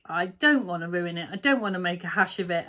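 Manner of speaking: ordinary talk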